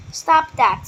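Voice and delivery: scolding. A narrator's reading. Scolding the dragon pup.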